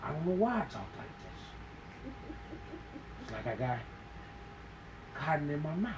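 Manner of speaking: lightly mumbled monologue, long pauses; mock dramatic actor. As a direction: play a brooding TV hero with a full mouth. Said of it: "why does he do that at the end of every sentence".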